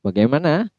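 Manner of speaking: usual speaking voice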